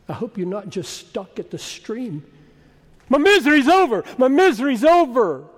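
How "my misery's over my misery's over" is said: high, loud, self-absorbed celebrating; hammed-up whoop of relief; mock jubilation. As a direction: crow with relief, imitating someone who only cares about themselves. Acts out people stuck at stream.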